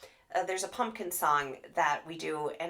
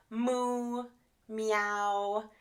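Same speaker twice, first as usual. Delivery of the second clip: a light cow moo then a cat meow; animal sounds. Shown as a demonstration. Therapist modelling animal sounds for little kids. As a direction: model the animal noises for a child. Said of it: "animal sounds like".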